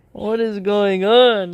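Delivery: real exasperation, drawn out; exasperated disbelief. Real, not acted. Baffled outburst at her claim.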